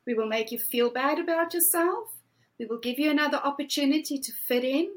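ordinary talk, nothing marked

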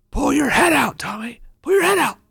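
"Pull your head out" yelled high and harsh by a mock coach; angry coach yell. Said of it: "the coach comes out and says".

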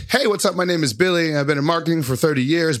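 high, chirpy stock intro voice; mock the generic friendly opener; boring ad intro. Mocking a dull marketer introducing himself to strangers, high and chirpy. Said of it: "So instead of being like".

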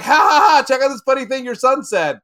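loud, high teasing cackle into the line; mom's mocking laugh. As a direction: needle him with a big fake laugh. Mom mocking dad with laugh.

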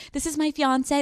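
mock a try-hard bride showing off. Mocking how people introduce a fiance, affected and high. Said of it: "it feels like a try hard somehow".

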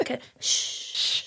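whispered, conspiratorial shush; playful shushing. Pretend. Co-host jokingly hushing the other, whispered shush. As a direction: hush them as if sharing a secret. Mock hushing, then "Don't tell.

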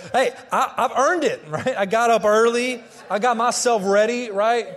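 hammed-up self-defence; mock defensive protest. Husband excusing himself at the coffee table, mock indignant.

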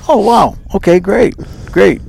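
retold delight at an invitation; excited. Retold reply to show invitation.

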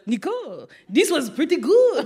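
a mock French accent, lightly done; playing Jacques with a French accent. Jacques praising the butter cake in a mock French accent. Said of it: "I do an amazing French accent".

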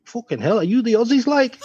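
imitating a Scouser, pitch climbing steeply at the end; mock-incredulous Liverpool accent, high-rising question; Scouse accent. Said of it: "this Scouser hobbled over and said".

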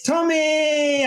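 high, slow, drawn-out announcer call; hype announcement. Acted. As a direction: announce the guest like a show host. Announcing the guest.

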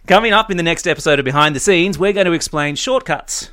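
upbeat announcer voice; brisk podcast outro read; announcer sign-off. Acted. Podcast sign-off over music.

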